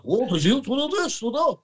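high, puzzled rising questions in a put-on voice; mock baffled Russians. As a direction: ham up a confused Russian. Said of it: "the Russians are like".

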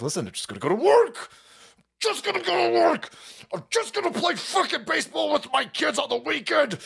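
a hammed-up exasperated dad; mock fed-up patriot. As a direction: play the guy who just wants his weekend, yelling. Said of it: "our side's like".

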